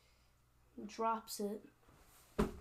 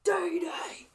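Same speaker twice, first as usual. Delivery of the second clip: high, loud, harsh burst; roared battle taunt; 8 semitones higher, 10 dB louder than usual. Acted. Highlander finishing off the orc, roared battle taunt. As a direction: explode on the words as the blade lands.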